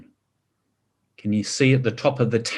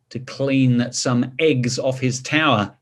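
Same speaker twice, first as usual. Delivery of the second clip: plain reading voice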